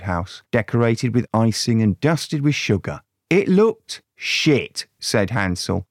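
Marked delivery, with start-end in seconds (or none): 3.3-4.9 very slow, higher scornful drawl; slow disdain. Acted. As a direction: stretch it out in disgust. Hansel's drawn-out complaint.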